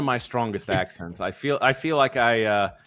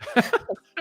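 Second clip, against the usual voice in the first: high laugh. Real, not acted. Laughs at the joke about blame.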